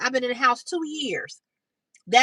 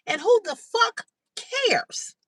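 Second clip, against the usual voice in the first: higher, slow, drawn-out disbelief; exasperated outburst; 9 semitones higher, slower than usual. Real, not acted. Venting at a commenter, slow outraged disbelief. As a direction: stretch every word out in outrage.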